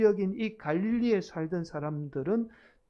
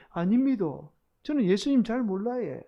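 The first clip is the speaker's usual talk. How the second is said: Gyeongsang dialect, pitched up in a fluster; 3 semitones higher than usual. Acted. Country Peter answering the servant girl, flustered Gyeongsang dialect. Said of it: "당황하게 되면 막 사투리가 나오잖아요".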